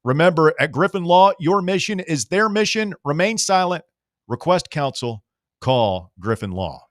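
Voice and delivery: loud, high slogan delivery; ad tagline. Acted. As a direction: punch the slogan like a radio ad.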